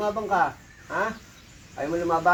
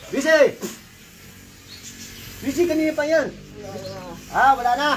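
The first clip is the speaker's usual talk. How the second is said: raised, higher-pitched urgent orders; impatient commands; 5 semitones higher than usual. Real, not acted. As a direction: drive them on forcefully, no patience left. Healer orders spirit to hurry up.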